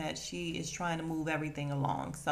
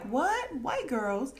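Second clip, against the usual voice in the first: surprised; 6 semitones higher, slower than usual. Retold or imitated. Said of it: "he's like".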